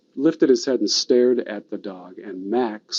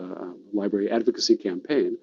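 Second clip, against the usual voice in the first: normal talking voice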